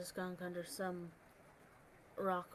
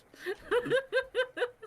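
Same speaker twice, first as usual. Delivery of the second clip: burst of laughter; 6 dB louder than usual. Real, not acted. Table laughs at broke joke.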